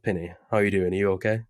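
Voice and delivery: ordinary talk, nothing marked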